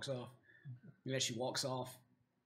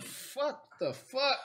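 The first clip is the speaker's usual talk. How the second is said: startled dwarf; 8 semitones higher, 3 dB quieter, much slower than usual. Acted. Dwarf falls under table.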